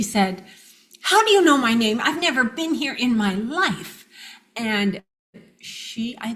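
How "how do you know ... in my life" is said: louder and higher, accusing; furious demand. Retold or imitated. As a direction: demand it like a stranger who feels spied on. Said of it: "And she is furious".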